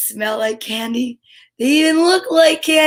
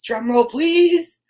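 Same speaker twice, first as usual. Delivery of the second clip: suspense build; 3 semitones higher, much slower than usual. Acted. Building suspense before reveal.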